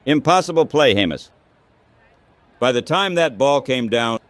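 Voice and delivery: ordinary delivery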